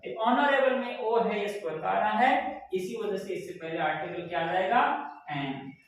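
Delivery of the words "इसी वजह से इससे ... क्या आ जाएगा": normal talking voice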